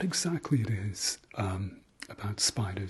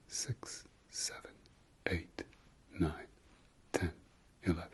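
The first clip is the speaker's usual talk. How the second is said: soft count with slightly shorter gaps; slightly quicker breathing count; 7 dB quieter than usual. Shown as a demonstration. Guide easing a breathing exercise for struggling listeners, a bit quicker count. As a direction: keep the breath count moving gently, still spaced out. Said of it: "let's go a bit quicker".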